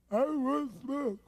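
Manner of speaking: high mock-tearful protest; hammed-up crying complaint; freaked-out whine. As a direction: repeat it as a pitiful wail. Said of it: "he's freaking out of his mind".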